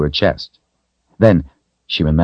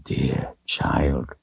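soft, low, tender wizard's near-whisper; 3 semitones lower, 8 dB quieter than usual. A narrator's reading. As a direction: breathe it gently to a child. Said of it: "he whispered".

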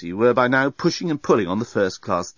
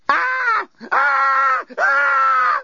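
high piercing repeated screams; primal scream; 5 dB louder, much slower than usual. Acted. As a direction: scream in sheer panic. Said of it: "continued Lucy".

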